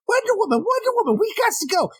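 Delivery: high-pitched little squirrel, urgent; squeaky squirrel voice. Retold or imitated. Squirrel urging Wonder Woman to flee, in a high, urgent voice. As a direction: chirp it out like a tiny frantic squirrel.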